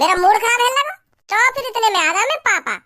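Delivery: usual speaking voice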